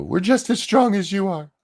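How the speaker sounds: high-pitched mimicked boast; mocking female voice. Host voicing women who tell us how strong they are, high mockery.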